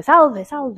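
a bright Roman salve, salve; Italian greeting. Retold or imitated. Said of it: "you hear people say".

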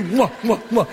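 exaggerated kissing noises; noisy smacking kisses. Gambler smothering the lucky kid, noisy smacking kisses.

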